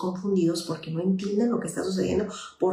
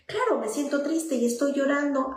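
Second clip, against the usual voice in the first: higher, lightly voiced child admitting sadness; a hint of a small child naming the feeling; voicing the child; 7 semitones higher than usual. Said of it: "tu hijo pueda decir". (voz de niño apenas imitada, más aguda)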